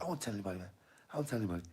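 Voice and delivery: put-on high voice; Ezel. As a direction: imitate a high-voiced promise. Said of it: "And Ezel's like".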